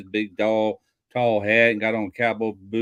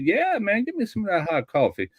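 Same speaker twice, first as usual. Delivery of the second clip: high-pitched, quick Cajun character asking for coffee; lightly voiced Thibodeau, keen and fast; eager Cajun joke voice; 10 semitones higher than usual. Joke teller voicing Thibodeau, higher and quick.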